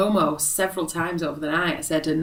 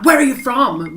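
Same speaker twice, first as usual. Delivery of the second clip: aggressive; 6 semitones higher, 5 dB louder than usual. Retold or imitated. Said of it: "Really aggressive".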